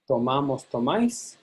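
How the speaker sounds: MA stressed in "Tomamos, tomáis"; stress moves to second syllable. Shown as a demonstration. Teacher models forms outside the boot, MA stressed in "Tomamos, tomáis". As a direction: let the weight land on MA in "Tomamos, tomáis". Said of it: "We let loose on that one".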